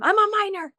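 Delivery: retold screech. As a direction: squeal it up high, replaying the moment. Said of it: "I screeched at him".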